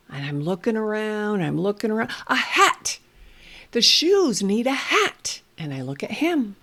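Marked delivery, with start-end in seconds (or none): 2.3-5.4 playful discovery. Shown as a demonstration. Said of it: "playing with toys".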